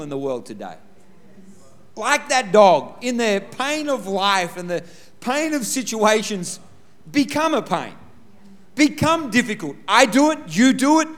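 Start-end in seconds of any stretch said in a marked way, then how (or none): 2.0-11.1 rising preacher's build, higher and louder; preaching. Acted. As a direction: drive the lesson home to the congregation. Preaching application.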